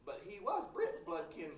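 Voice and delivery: normal talking voice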